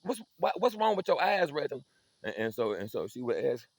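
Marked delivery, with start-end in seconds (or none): 0.0-1.8 high, slowing interrogation; a mother squinting into her son's eyes; suspicious mama. Retold or imitated.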